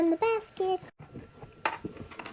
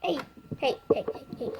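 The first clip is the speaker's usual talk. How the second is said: normal talking voice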